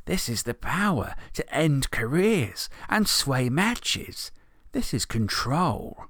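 slow, savoring menace from an orc boss; villain savoring control, slow; slow menacing orc boss. Acted. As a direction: relish every word of power. Grun's menacing speech.

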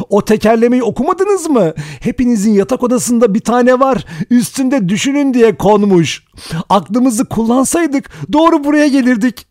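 shrill, very high hysterical ranting; Vera's strange crazed voice. A narrator's reading. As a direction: rant loudly at the top of your pitch, tipping into mad laughter. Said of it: "Vera aynı acayip sesle konuştu". (çıldırmış gibi tiz, acayip sesle)

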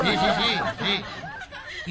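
high breathy giggling through the words; giggly laugh. Real, not acted. Laughing at own joke.

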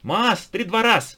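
high, loud, slow madman chant; wild unhinged ranting. A narrator's reading. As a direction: rant it like a raving lunatic. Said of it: "ответил сумасшедший".

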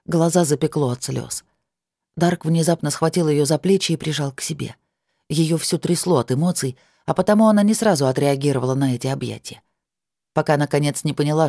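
ordinary delivery, nothing marked

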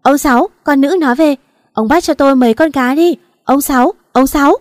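put-on old woman calling out to her husband, loud and excited; loud yelling. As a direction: shout it across the yard. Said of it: "miệng thì la oai oái".

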